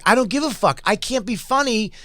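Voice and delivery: mock his fed-up refusal; retelling a furious comic, pitched high; defiant outburst. Comic defying the producers.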